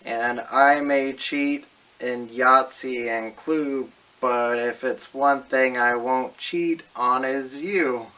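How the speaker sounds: loud, slow smooth-talking pitch; smooth ladies' man. Acted. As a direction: lay on the cheesy charm slowly. Dating video, smooth seductive pitch.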